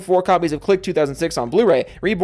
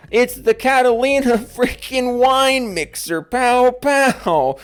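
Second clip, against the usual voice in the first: movie quote impression; 7 semitones higher, 3 dB louder, slower than usual. Movie quote from fan name.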